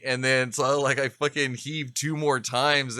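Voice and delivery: ordinary delivery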